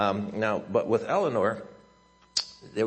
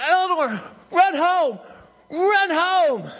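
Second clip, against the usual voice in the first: very high, very loud holler; desperate coach's bellow, reenacted; yelling full out; 10 dB louder, slower than usual. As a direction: scream the name as loud as you can. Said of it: "yelling as loud as I can".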